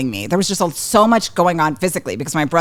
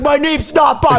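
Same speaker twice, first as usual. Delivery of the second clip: indignant yell; 9 semitones higher than usual. Retold or imitated. Brother protesting his sister's teasing, indignant yell. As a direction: imitate an enraged brother protesting. Retold brother yelling back at taunt.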